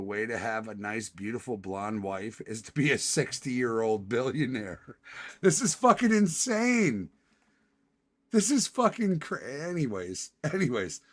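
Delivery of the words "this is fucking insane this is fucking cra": much higher, louder exclamation; outraged outburst. Real, not acted. Streamer ranting at the news, loud high outrage. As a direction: blow up at how absurd it is.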